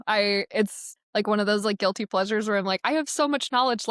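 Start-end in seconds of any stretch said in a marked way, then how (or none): 2.8-3.9 higher-pitched, self-mocking keenness; playful eagerness. Mentor keen to share with newcomers, playful eagerness. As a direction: gush as if bursting to share. Acts out guilty pleasure of advising.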